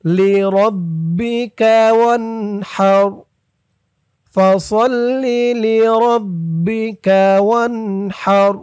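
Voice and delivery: slow melodic tajwid chant; measured Quran recitation; Quranic recitation. Shown as a demonstration. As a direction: recite slowly with a drawn-out melody. Recites verse.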